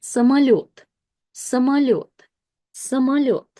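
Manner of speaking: "самолёт" as sa-ma-LYOT, stress last; reduced unstressed o. Shown as a demonstration. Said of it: "o switches to a, so we'll pronounce it".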